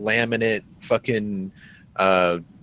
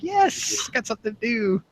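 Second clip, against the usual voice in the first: mock-celebrate in a high voice; gleeful yes; 13 semitones higher, 4 dB quieter than usual. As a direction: squeal it lightly, pleased to have a job. Acts out own glee at task.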